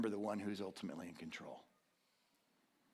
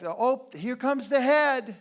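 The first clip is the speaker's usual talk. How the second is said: nurse announcing; 11 semitones higher, 12 dB louder, slower than usual. Retold or imitated.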